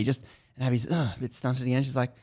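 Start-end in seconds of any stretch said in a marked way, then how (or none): none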